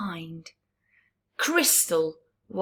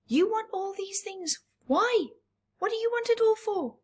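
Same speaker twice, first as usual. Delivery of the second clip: lightly voiced crab; 12 semitones higher, faster than usual. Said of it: "She's telling him".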